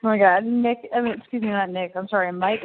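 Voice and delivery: ordinary delivery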